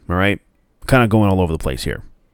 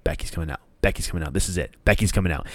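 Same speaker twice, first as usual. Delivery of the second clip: excited whisper; 6 semitones lower, 8 dB quieter, much faster than usual. Retold or imitated. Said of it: "I'm going to my girlfriend".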